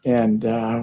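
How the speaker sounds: ordinary delivery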